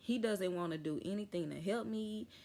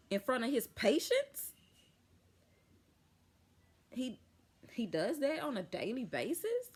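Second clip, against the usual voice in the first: retold indignant outburst; 5 semitones higher than usual. To the nurse about the rude doctor, outraged disbelief. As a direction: fire back in disbelief at how he treats staff.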